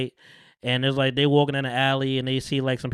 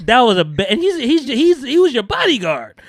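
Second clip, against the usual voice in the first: high, loud, stumbling outburst; loud incredulous cry about to break into laughter; exasperated disbelief; 10 semitones higher, 8 dB louder than usual. Real, not acted. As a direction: sputter in amused disbelief, voice shooting up.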